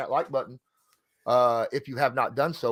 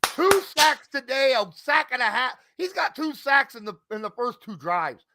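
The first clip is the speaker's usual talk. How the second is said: excited; 12 semitones higher, 3 dB louder than usual. Real, not acted. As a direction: hype up the pass rusher. Raving about sacks.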